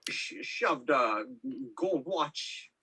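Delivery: slushy mock Danish-Scottish accent, very drawn out; slow put-on accent, every s turned to sh; Sean Connery. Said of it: "which is Danish Sean Connery or something".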